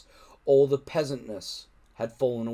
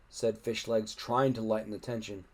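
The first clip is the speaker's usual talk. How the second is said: ordinary delivery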